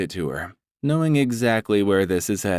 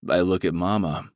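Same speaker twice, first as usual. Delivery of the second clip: usual speaking voice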